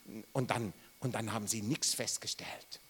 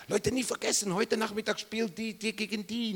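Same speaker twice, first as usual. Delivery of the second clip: eager announcement; 9 semitones higher than usual. Pretend. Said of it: "Und dann nachher".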